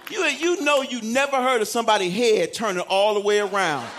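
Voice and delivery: ordinary talk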